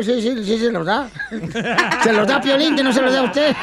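laughing while teasing. Real, not acted. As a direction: chuckle through the jab.

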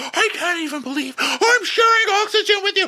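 very high mock shriek; Shep. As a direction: squeal it in hammed-up disgust. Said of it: "And Chef's like".

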